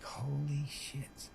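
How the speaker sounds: slow, soft, stunned under the breath; awestruck hush. Retold or imitated. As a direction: let it slip out in quiet awe. Awed reaction in AA meeting.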